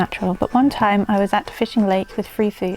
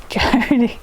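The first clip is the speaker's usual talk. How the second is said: failed gruff voice; 2 semitones higher, 3 dB louder than usual. Retold or imitated. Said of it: "I can't do a low gr-, low gruff voice".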